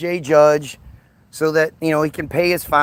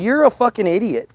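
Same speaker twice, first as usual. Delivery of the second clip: ordinary talk, nothing marked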